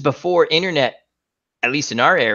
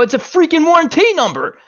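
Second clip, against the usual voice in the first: much higher, louder frustrated retort; exasperated; 4 dB louder than usual. Retold or imitated. Telling the boss it's really the warranty number, exasperated and higher. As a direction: insist in disbelief. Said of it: "I go".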